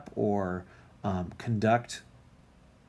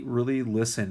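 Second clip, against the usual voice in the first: normal talking voice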